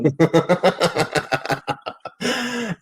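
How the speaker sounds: high burst of laughter; loud laugh. Real, not acted. Big laugh at the joke.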